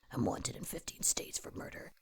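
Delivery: low menacing whisper. Pretend. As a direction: whisper it like a killer. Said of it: "Or I can do something like".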